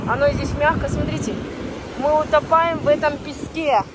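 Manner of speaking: ordinary talk, nothing marked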